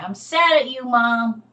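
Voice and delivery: put-on high, loud little-kid pout; child's sulky complaint. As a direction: play the pouting child telling Mom off. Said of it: "but they said".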